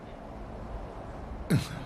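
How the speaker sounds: quiet emotional chuckle; a soft laugh, moved; soft breathy laugh. Real, not acted. Moved elder laughs softly.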